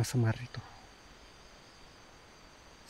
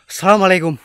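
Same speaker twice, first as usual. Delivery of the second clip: called out, higher and loud; loud greeting; 6 semitones higher, 11 dB louder, faster than usual. Real, not acted. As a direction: call out the greeting. Greeting the spirit loudly.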